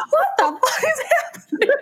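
very high mock-incredulous outburst; bewildered, through laughter. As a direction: squeal it out while cracking up. Retelling Ajax's bewildered reaction, laughing.

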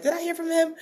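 high-pitched mock doubting question; second-guess yourself in a squeaky-high voice; self-doubt. Speaker doubting she heard from God, high and unsure. Voicing her own sudden doubt.

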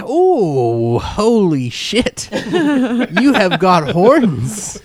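amazed shopkeeper cracking up mid-line; laughing shopkeeper. Acted. Shopkeeper gawking at the horned customer, laughing. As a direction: gasp it out through laughter, slowly. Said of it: "He's like".

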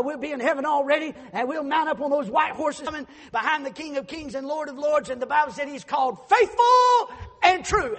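shouted, high, ringing sermon climax; fiery preacher at the peak of the sermon; full-force preaching. Acted. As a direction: preach it out to the rafters, loud and high.

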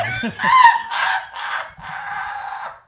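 high unvoiced screech laugh; shrieking laugh. Real, not acted. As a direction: cackle wildly. After the impression.

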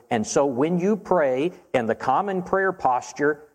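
normal talking voice

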